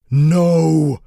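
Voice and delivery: high, loud furious roar; jester's roar. Acted. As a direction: roar it out in fury. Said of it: "roared the jester".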